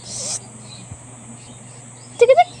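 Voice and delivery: high squeaky tikitik. Real, not acted. Playful sound to baby.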